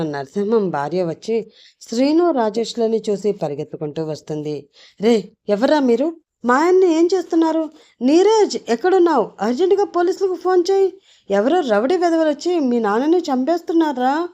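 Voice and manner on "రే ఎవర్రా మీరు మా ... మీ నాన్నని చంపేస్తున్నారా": high, loud panicked yelling at the intruders; a wife's high, loud cries for the police; frantic shouting. A narrator's reading. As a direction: scream for help, calling the son. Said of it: "అంటూ గట్టిగా అరుస్తూ వెళుతుంది".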